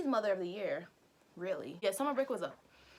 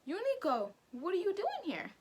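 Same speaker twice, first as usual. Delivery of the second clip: surprised teacher; 10 semitones higher than usual. Retold or imitated. Surprised teacher seeing him.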